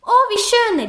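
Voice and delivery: high, loud delighted call; a wife's gushing reply from afar; Bella calling back. Acted. Bella calls back to her husband, high and delighted. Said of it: "rief Bella zurück".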